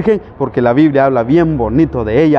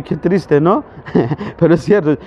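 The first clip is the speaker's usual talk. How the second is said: ordinary talk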